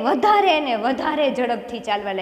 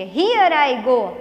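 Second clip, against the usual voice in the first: ordinary talk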